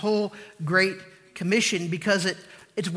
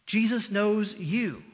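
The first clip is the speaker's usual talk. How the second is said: slow emphasis; much slower than usual. Shown as a demonstration.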